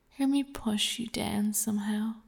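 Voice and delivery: normal talking voice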